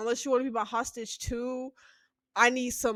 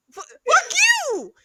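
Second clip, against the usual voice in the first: high, loud curse; mock shout; 11 dB louder than usual. Stranger cursing back at her, hammed-up shout. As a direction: burst out with it. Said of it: "And he was like".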